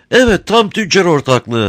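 high, sweet-talking crafty smuggler; sly, sugary old rogue; honeyed voice. A narrator's reading. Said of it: "tatlı konuşuyor".

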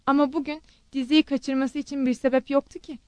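ordinary delivery, nothing marked

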